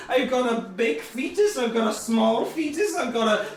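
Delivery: put-on over-the-top Irish accent. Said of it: "I've changed me accent since last I was here".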